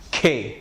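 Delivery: "کھے" alone with strongly aspirated k; puff of air. Shown as a demonstration. Teacher repeating K for Hindi spelling, aspirated pronunciation. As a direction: release breath after the k of "کھے". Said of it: "ایک puff of air کہتے ہیں".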